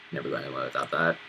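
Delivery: low quiet aside under breath; muttered aside. Real, not acted. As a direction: drop low and soft for a quick aside. Aside about his hat.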